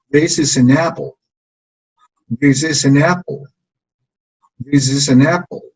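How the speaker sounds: This is an apple with This and apple stressed; model for repeating. Shown as a demonstration. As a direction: say it for the class to copy. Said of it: "Vamos desde arriba".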